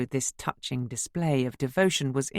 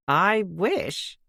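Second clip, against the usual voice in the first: tight-lipped; 6 semitones higher, 4 dB louder than usual. A narrator's reading. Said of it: "tight-lipped smile".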